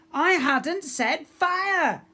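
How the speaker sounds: slow, very loud high furious shout; losing her temper. A narrator's reading. Pirate girl losing her temper at her mate, slow loud shout. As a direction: shout each word, stamping. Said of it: "clearly losing her temper".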